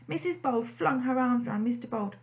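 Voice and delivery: plain reading voice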